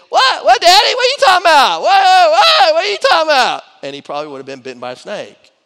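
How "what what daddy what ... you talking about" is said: mock whiny child. Son mocked, questioning father.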